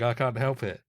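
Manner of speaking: ordinary talk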